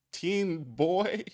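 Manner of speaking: usual speaking voice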